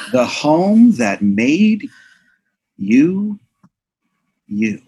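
slow, halting, portentous; like a movie trailer voiceover; dramatic narration. Pretend. Dramatic trailer-style home.

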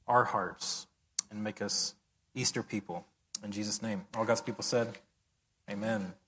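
quiet, low prayer voice; hushed Sunday prayer; pastoral prayer. Acted. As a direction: pray over the congregation softly. Leads a congregational prayer.

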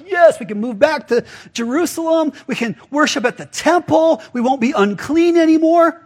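high-pitched, elated imagined reaction; acted excitement. Samaritans hearing they can return to Jerusalem, acted excitement. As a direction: voice the thrilled hope of an outcast people. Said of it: "this is exciting".

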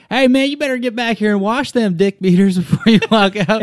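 high, brash Southern twang calling across the bathroom; a loud Texas boy with a New Jersey heart; Southern accent. Retold or imitated. Donnie scolding a tall guy to wash his hands, brash Southern call-out. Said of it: "how would you describe Donnie's accent".

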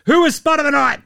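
high, loud segment call; announcer shout. Acted. Host introducing the worst-performer segment, high announcer shout. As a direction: shout it to launch the jingle. Calls out segment title before jingle.

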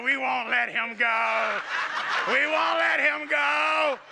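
loud, slow, laughing demon growl; mock demon. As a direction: ham up a possessed voice from the floor. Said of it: "I fell down on the ground, and I said".

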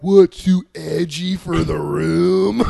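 slow, loud, laughing comic; edgy comic bat. Pretend. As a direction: deliver it like a defensive open-mic comic. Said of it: "The bat's just on your roof like".

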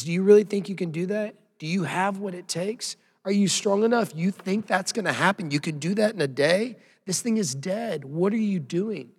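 mocking taunt from the enemy's voice; voice of ridicule. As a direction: sneer down at them like a bully. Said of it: "that's what the voice of ridicule sounds like".

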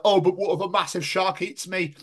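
high-pitched imitation of a worrier; mock worry. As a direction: mockingly fret in a high voice. Mocks the nervous friend's shark worry.

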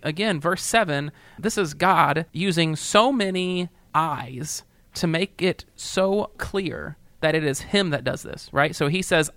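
ordinary delivery

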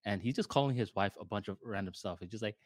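normal talking voice

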